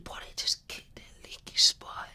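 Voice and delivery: hushed, disbelieving repeat of the news; stunned whisper. Retold or imitated. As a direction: whisper it, shaken. Retold stunned reaction to news.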